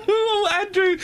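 high, through real laughter; amused, high-pitched laughing words. Real, not acted. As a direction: say it while still laughing. Recovering from laughing at Andrew.

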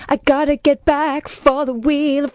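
mock Rain Man. Co-host spoofing Rain Man's fixation, slow insistent voice. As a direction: repeat it insistently, slowly. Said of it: "He's obsessed with Wheel of Fortune".

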